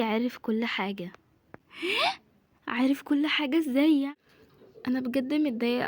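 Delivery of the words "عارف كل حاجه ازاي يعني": sharp gasp, then higher disbelieving question; shocked. Acted. Friend hears Yahya knows everything, gasping in shock. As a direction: gasp at the news, then demand how.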